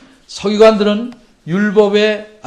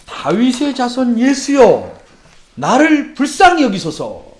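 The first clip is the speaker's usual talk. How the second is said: raised, drawn-out plea; crying out; 5 semitones higher, 3 dB louder, slower than usual. Acted. Blind beggar shouting to Jesus for pity, crying out. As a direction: call out slowly and higher, begging for pity. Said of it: "뭐라고 소리를 질렀냐면".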